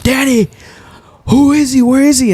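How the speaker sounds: officer demanding Danny point him out; urgent cop. Retold or imitated. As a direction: press Danny hard for where he is.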